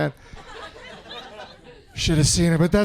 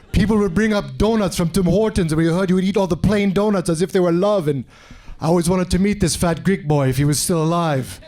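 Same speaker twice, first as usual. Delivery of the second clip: put-on African accent. African stranger thanks his supposed benefactor, put-on African accent. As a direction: thank him solemnly in a vague African accent. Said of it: "what part of Africa that accent from".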